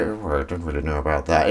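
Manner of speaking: soft put-on Kenneth Williams musing. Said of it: "he speaks very".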